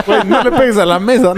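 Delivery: high, loud words through laughter; laughing. Real, not acted. Friend laughing at the teller, telling him not to hit the table. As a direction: crack up while scolding.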